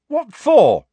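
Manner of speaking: sharp, high and loud retort; indignant shout; angry outburst. A narrator's reading. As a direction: snap it back in outrage. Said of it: "said Harry angrily".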